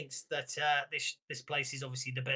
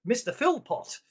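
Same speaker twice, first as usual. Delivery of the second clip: loud, high, gushing welcome; hammed-up delighted hostess; sudden effusive greeting; 7 semitones higher, 13 dB louder than usual. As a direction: burst out as if greeting an old friend. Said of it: "He suddenly goes".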